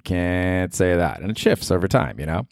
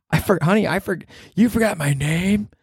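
put-on girlfriend voice; 12 semitones higher than usual.

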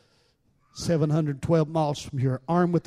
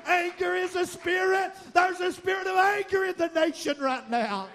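fiery preaching; 11 semitones higher than usual. Acted. Pastor whooping to the congregation, fiery preaching. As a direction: preach it at full force, building.

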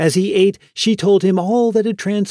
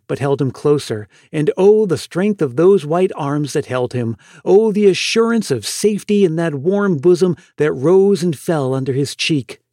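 usual speaking voice

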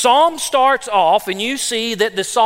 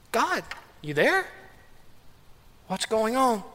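much softer private plea to God; inner plea; 10 dB quieter than usual. Retold or imitated. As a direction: ask it quietly, as if only in your head. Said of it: "wondering to yourself".

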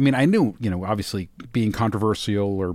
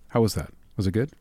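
ordinary talk, nothing marked

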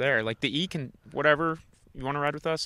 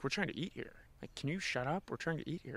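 softer, mildly annoyed diners asking for peace; a hushed grumble from the elderly couple; quiet complaint; 3 semitones higher, 12 dB quieter than usual. Retold or imitated. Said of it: "they're like".

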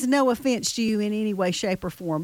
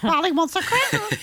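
higher squawky parrot; 6 semitones higher than usual. Pretend. Repeated parrot imitation.

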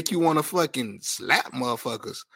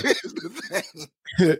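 mocking echo; 2 semitones higher, much slower than usual. Mockingly echoes the other speaker.

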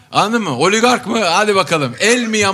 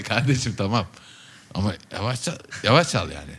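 lower, softer acted plea; mild complaint, retold; 6 semitones lower, 5 dB quieter than usual. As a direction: imitate a listener quietly asking for restraint. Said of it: "Diyorlar ki". (alçak sesle, hafif sitemli taklit)

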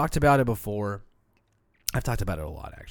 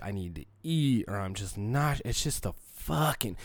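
ordinary talk, nothing marked

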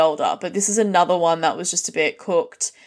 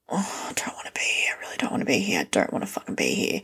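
whisper; 12 semitones lower, 7 dB quieter, faster than usual. Retold or imitated. Horrified thought on walking in.

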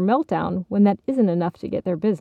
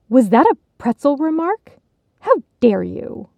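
high, loud affronted question; indignant, hammed-up offence; offended; 5 semitones higher, 5 dB louder than usual. A narrator's reading. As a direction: react as if gravely insulted. Gasping basket takes offence.

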